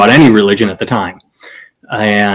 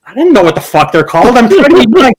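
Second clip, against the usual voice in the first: exasperated protest; 10 semitones higher than usual. Retold or imitated. Retold exasperation at the father.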